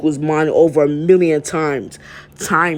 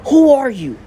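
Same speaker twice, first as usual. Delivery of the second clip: high, loud panicked-fan yell; retold scream; 7 semitones higher, 4 dB louder than usual. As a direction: cry out in shock, pitch jumping up. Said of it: "Izuku screams, saying".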